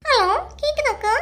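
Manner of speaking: a village woman putting on airs on the phone; stylish talk. Acted. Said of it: "நானும் தெரிஞ்சவரை கூட ஸ்டைல்ல பேசியாமா".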